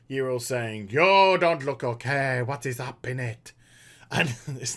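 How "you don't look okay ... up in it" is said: low, put-on Draenei accent; Draenei accent impression. Yril speaking to Khadgar, mock Draenei accent. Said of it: "that's my Draenei impression".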